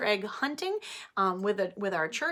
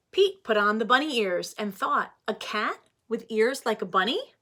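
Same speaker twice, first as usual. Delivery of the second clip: put-on reading to children; 3 semitones higher, 4 dB louder than usual. Teacher reading Pete the Cat aloud to her class, animated. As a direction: read it like story time for little ones.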